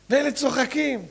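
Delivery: ordinary delivery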